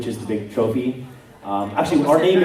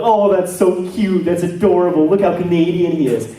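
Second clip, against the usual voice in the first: cooing friends; 6 semitones higher, 6 dB louder than usual. Retold or imitated. Voice actor friends at dinner, cooing high and loud. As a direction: gush like friends fussing over a puppy.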